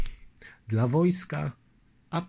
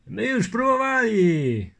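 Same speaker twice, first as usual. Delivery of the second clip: higher, louder crowd voice; chorus of nobles; 11 semitones higher, 6 dB louder, slower than usual. Acted. Nobles answering the lieutenant, a raised crowd reply. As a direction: several nobles calling out.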